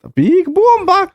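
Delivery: high, slow silly voice; mock Gungan voice. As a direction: squeak it like Jar Jar. Mocking echo of the name.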